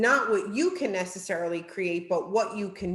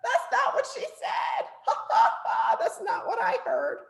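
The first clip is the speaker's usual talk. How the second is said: much higher, laughing and half shouting; laughing protest; 14 semitones higher than usual. Retold or imitated. The group laughing at Bart's garbled answer, laughing shout. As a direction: cry out in amused disbelief through a laugh. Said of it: "And we all laugh".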